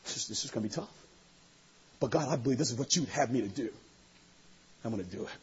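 quiet prayer. Pretend. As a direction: talk to God under your breath.

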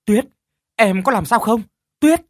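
loud high frightened call; panicked call. Acted. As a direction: call out in alarm. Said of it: "Khánh hoảng hốt". (gọi hoảng hốt)

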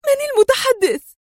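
very high, tense question; high-strung, urgent query; extremely tense. A narrator's reading. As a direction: ask it at peak tension. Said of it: "في توتر بلغ ذروته".

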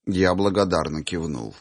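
ordinary delivery

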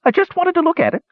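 very high, quick gnome whine; squeaky, cringing little gnome; whining gnome. A narrator's reading. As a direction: whine defensively, high and fast. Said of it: "whined the gnome".

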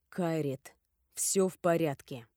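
low, gentle reassurance; soothing. A narrator's reading. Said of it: "успокаивающим голосом произнёс наследник". (успокаивающим голосом)

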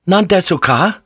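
high, loud irritable question. A narrator's reading. Omi repeats his question to silent Blackthorn, irritable. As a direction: demand it impatiently, voice pushed up. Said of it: "repeated more irritably".

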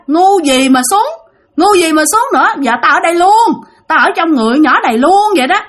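high-pitched stubborn spirit; vong cãi bướng. Acted. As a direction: dig in and refuse to leave. Vong cãi, không chịu đi. (giọng vong cao, bướng bỉnh)

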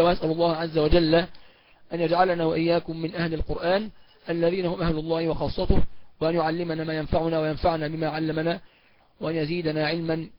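low soft supplication; closing prayer. Acted. Teacher ending the lesson with a prayer for students. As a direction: close with a quiet prayer.

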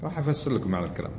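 ordinary talk, nothing marked

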